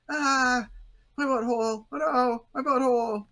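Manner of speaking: high, loud wailing in fake agony; hammed-up anguished yelling; mock pain. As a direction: cry out like a cartoon man writhing on pavement. Acting comic's man lying in pain.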